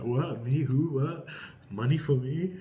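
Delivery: mock shocked disbelief. Reader playing the lucky client hit by sudden money, mock shocked. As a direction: act out a goofy, stunned double-take. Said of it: "you're so shocked".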